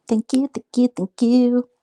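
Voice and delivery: high, loud repeated thank yous; gushing thanks. Real, not acted. Streamer gushing thanks to a donor, excited. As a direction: pile on the thanks, delighted.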